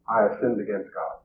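soft, low, subdued admission; understated confession, dropped low and soft; retold confession. Quotes the humble confession again.

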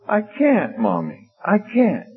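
put-on high, whiny little-boy voice; crying child. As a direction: imitate a tearful boy protesting to mother. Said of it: "He's crying".

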